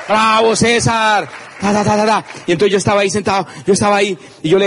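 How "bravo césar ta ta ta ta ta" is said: crowd cheer. Retold or imitated.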